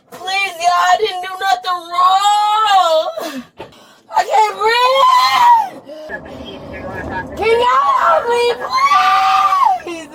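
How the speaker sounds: drawn-out crying wail; real desperate wailing; breathless distress. Real, not acted. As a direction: build from tearful plea to screamed begging.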